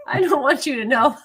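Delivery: sheepish laughing reply. Real, not acted. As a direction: dodge the question with a giggle. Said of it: "How many do you have, by the way?".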